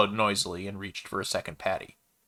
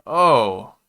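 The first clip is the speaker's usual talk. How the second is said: light, offhand oh; airily; 3 semitones higher, 9 dB louder than usual. A narrator's reading. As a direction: toss it off casually. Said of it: "he said airily".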